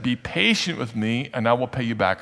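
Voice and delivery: usual speaking voice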